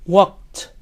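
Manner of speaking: normal talking voice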